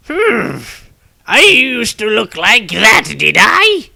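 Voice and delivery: put-on high, slow old man musing; old wizard voice. Old wizard eyeing his younger self, high and slow. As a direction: lift the voice up high like an ancient wizard looking back. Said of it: "The newcomer said".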